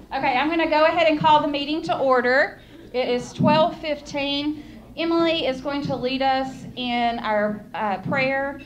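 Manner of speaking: loud, slow projecting voice; calling down a hallway. Real, not acted. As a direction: project it to the back of the room. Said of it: "you have to talk this way".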